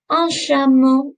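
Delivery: "chameau" slow, soft "sh" start, "o" ending; teacher models "Un chameau" slowly with soft sh; soft sh sound. Shown as a demonstration. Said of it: "It's a sh sound".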